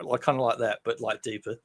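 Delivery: normal talking voice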